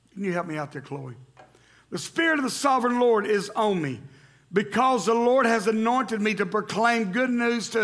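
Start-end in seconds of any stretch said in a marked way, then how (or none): 1.9-7.8 raised, ringing scripture read. Acted. Pastor reading Isaiah aloud to church, ringing. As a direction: proclaim the verses to the congregation. Reads verse with congregation.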